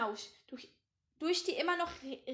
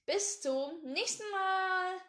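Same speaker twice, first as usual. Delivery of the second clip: cheery goodbye; 5 semitones higher, 4 dB louder, much slower than usual. Real, not acted. Cheerful sign-off to listeners.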